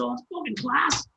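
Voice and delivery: high, thrilled exclamation; delighted. Retold or imitated. Praising the bandmate's harp part, high and thrilled. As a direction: gush about it, way up high.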